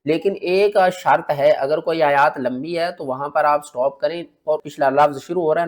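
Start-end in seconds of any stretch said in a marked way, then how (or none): none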